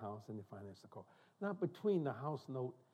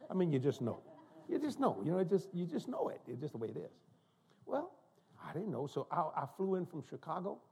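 ordinary delivery

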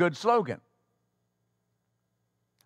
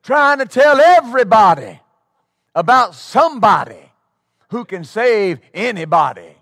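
testimony shout; 4 semitones higher, 8 dB louder than usual. Retold or imitated. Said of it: "they'll say".

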